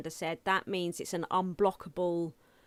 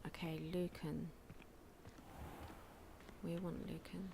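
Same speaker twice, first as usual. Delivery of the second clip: whispering; 14 dB quieter than usual. Real, not acted. Sneaking past quietly.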